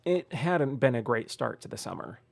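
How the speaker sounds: ordinary delivery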